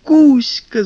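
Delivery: high, bright excited shout; excited cry. Acted. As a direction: call out with delight. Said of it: "закричала она".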